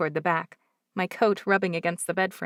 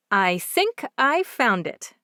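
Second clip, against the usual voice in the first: louder and slower, a bit proud; said loudly; 5 semitones higher, 6 dB louder, slower than usual. A narrator's reading. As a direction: announce it proudly to the room. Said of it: "I say loudly".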